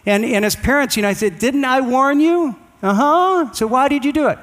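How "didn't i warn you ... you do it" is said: scolding parent. Retold or imitated. Parent scolding crying child.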